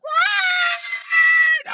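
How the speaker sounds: long high yell of Right; shouted Right. Retold or imitated. Driver whooping as he swerves into traffic, high yell. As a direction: holler it out as he swings out.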